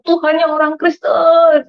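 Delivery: high, drawn-out wailing call to God; retold crying prayer. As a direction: call out slowly as if crying, re-enacted. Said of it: "Saya nangis ke belakang kontrakan". (seruan tangis yang ditirukan)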